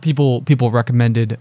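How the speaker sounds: ordinary talk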